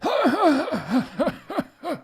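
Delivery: a shrill wizardly laugh; high old-man cackle. Acted. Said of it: "Dumbledore started to cockle".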